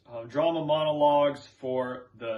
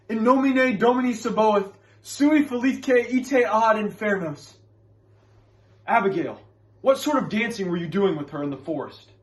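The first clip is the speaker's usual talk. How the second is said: loud, commanding exorcism, then pointed questioning; Reverend Hale; 6 semitones higher, 7 dB louder than usual. Acted. Reverend banishes spirits and questions Abigail, commanding. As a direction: command the spirits in raised, ringing Latin. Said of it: "are from the character Reverend Hale".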